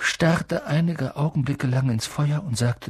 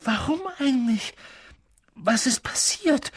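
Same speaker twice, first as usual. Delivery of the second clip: pressing, higher-pitched questions; urgent; 10 semitones higher, 3 dB quieter than usual. A narrator's reading. Boy asking what happened, urgently. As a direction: press anxiously for an answer. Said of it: "fragte Harry drängend".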